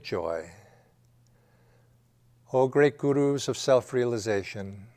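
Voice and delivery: prayer. Acted. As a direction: pray aloud in measured, spaced phrases. Leads an opening prayer.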